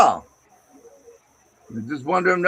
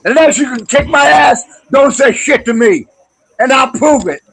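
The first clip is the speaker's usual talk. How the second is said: loud, higher, furious yelling; angry shouting; 4 semitones higher, 7 dB louder than usual. Real, not acted. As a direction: shout him down as a threat. Furious threat in stream argument.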